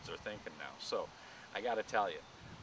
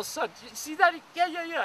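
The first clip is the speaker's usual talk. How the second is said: much higher and louder, giddy voice; excited high calling; 11 dB louder than usual. Retold or imitated. Dad calling out to his son at the baboon pen, giddy high shout. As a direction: call out to the kid like a thrilled child.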